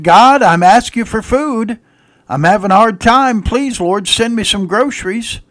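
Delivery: high, loud imitated plea to God; praying aloud. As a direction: call out a needy lady's prayer. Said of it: "the elderly lady was praying to God".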